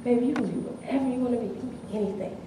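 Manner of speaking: tender reply. Retold or imitated. Father answering daughter softly.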